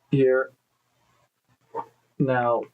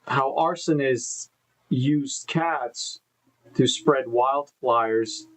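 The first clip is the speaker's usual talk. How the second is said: drawn-out, deliberate newsreader delivery; slow, halting headline read. Real, not acted. Streamer previewing tonight's stories on a lagging stream, slow and halting. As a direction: stretch out each headline, phrase by phrase. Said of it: "I don't know why I'm talking like this".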